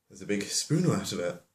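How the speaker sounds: ordinary talk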